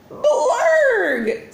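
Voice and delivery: very high loud alien blurg with a laugh; delighted alien exclamation; alien wow. A narrator's reading. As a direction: shout it like a thrilled alien. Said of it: "said all the clerks".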